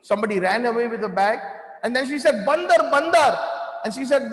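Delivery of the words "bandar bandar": loud, high cry of alarm; mock yell of a frightened pilgrim; retold scream. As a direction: shout it like an old lady calling for help. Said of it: "One older lady was screaming".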